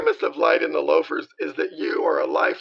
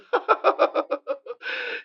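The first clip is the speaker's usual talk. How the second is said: high breathy laugh at the joke; 11 semitones higher than usual. Acted.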